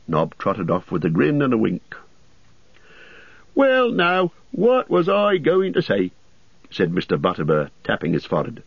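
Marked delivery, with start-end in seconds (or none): 3.5-6.1 high landlord voice, slow and musing; the host searching his memory; puzzled innkeeper. A narrator's reading. Landlord muses.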